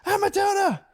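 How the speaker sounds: high shrieking kid voice imitating daughters; excited kids. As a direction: squeal it like excited little kids. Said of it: "They're like".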